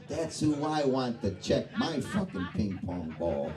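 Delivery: ordinary talk, nothing marked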